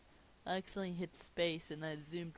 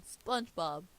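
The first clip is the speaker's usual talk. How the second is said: ordinary talk, nothing marked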